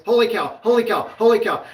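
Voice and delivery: high, loud repeated cries; re-enacted rider's exclamations. Retelling a scared rider behind us, mock exclaiming. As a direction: mimic a rider crying out over and over.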